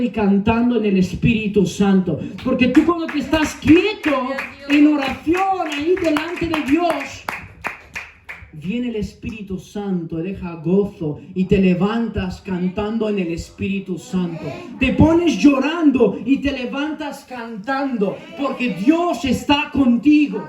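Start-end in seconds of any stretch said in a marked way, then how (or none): 2.4-7.2 impassioned preaching. Real, not acted. Preaches at full force. 14.8-20.4 high-pitched, drawn-out preaching cry; impassioned preaching. Real, not acted. Preaches at full force.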